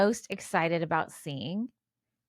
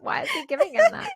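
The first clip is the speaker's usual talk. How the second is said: incredulous; 4 dB louder, faster than usual. Real, not acted. Shocked reaction to story.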